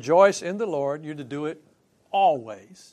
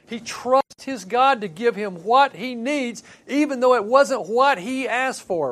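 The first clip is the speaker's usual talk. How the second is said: louder and higher, building conviction; fervent preaching; 7 semitones higher, 6 dB louder than usual. Acted. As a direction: lift the congregation with it. Preaching at full force.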